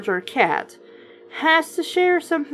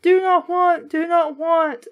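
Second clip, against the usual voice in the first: mock scared bunny; 6 semitones higher than usual. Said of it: "is like".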